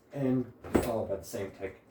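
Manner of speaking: speaking through hiccups; words broken by a hiccup. Real, not acted. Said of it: "I got the hiccups".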